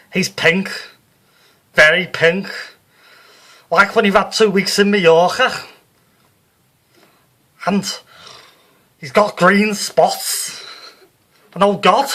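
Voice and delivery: loud, high Liverpool medium, growing alarmed; Psychic Sue in a trance. Acted. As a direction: describe the vision with mounting dread, brassy. Sue describes vision, alarmed.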